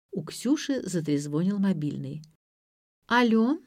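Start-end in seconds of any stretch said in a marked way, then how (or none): 3.1-3.6 higher АЛЛО with a rising tune; sing-song. A narrator's reading. Granddaughter answers her mobile, АЛЛО sing-song and rising. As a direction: lilt АЛЛО upward in a higher voice. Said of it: "нараспев произнесла она".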